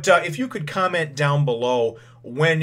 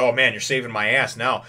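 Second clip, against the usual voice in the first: ordinary talk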